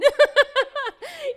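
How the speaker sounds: big helpless high-pitched laugh; loud high laugh. Real, not acted. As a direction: burst out laughing loudly. Laughing at her own meltdown.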